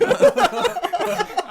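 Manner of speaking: squealing burst of real laughter; real high-pitched cackle; high laughing shriek. Real, not acted.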